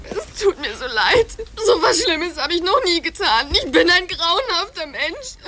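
sobbing through self-reproach; tearful sobbing apology; crying. Acted. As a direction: weep it out, full of remorse. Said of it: "Wieso weinst du denn jetzt schon wieder?".

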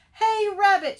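high, loud bird shout. Acted. Famished Bird hails Rabbit, shouting. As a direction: yell for attention at a high pitch. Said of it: "shouted Bird".

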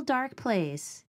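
ordinary delivery

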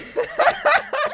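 high, loud burst of laughter. Real, not acted. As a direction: burst out laughing at full volume. Laughing at friend's reaction to ad.

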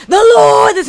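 high-pitched mock righteous outburst; angry church lady. Retelling the church lady's rant, angry. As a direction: imitate her angrily invoking the Lord. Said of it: "her voice sounded so angry".

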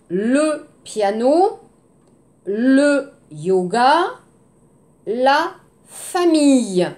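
le piano, le yoga, la famille drawn out slowly, each word given its full French sound; teacher modelling French words slowly; slow careful French. Shown as a demonstration.

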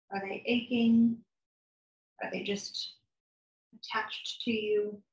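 calm, soft guided meditation voice, unhurried with long pauses; guided body scan. Acted. Therapist guiding a listener through a body scan, calm and soft. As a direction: lead them gently through the body.

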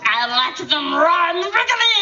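eager creepy Gollum. Pretend. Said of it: "And finally, Shay Shay".

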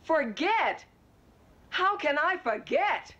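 indignant retort. Acted. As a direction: throw it back at him, drawing it out. Wife throws husband's word back.

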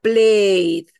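"Played" as one long, loud syllable ending in D; phonetic demonstration. Shown as a demonstration. Teacher showing how the word is pronounced, phonetic demonstration. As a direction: draw out "Played", the E absorbed by the D. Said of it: "así se pronuncia la palabra".

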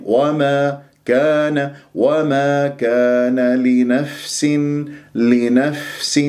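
نفس with the ف held so the breath flows; clear sukoon fa. Shown as a demonstration. Teacher models the ف of لنفس slowly. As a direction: let the air run on the ف of لنفس. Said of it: "شوف الفاء الساكنة".

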